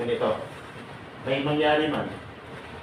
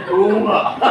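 laughing mock agreement; 6 semitones higher, 8 dB louder than usual. Audience voiced, laughing.

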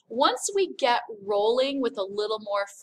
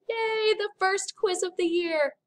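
mock cheer; 9 semitones higher than usual. Teacher mock cheers about quiz.

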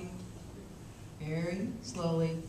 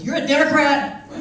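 higher, much louder laughing disbelief; surprised laugh; 8 semitones higher, 9 dB louder than usual. Retold or imitated. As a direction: burst out amazed and laughing. Old man surprised.

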